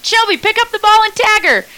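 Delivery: high, loud call to a teammate; retold urgent yell. Player urging Shelby on a bunt, retold yell. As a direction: re-enact yelling an order across the field. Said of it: "I'm yelling at the top of my lungs".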